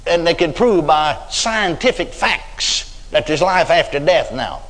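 ordinary talk, nothing marked

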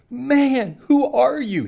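high, loud astonished cry; amazed outburst. Retold or imitated. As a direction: blurt it out in shock. Stunned man jumps up excited.

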